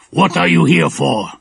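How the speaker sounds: loud, menacing question; gruff villain. A narrator's reading. Shaggy-browed man interrogating the girls, menacing. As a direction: demand it like a thug. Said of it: "asked the shaggy-browed man".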